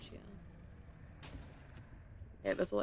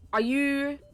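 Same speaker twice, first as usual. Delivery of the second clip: startled yell; 7 semitones higher, 9 dB louder than usual. Real, not acted. Startled mid-dive.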